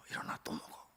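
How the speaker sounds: whispered gentle wake-up; soft whisper to rouse a tired man. Acted. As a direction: breathe it like waking a sleeper. God waking Elijah again.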